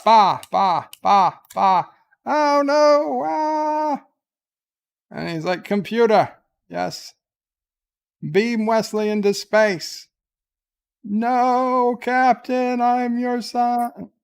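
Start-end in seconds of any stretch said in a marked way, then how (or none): 0.0-4.0 silly mock wail. Silly noises, then a high wail. 5.6-10.1 mock commanding order. Captain orders the computer. 11.0-14.1 much higher and slower, desperate begging; hammed-up son pleading with the captain; high pleading wail. Wesley begs as he is beamed away.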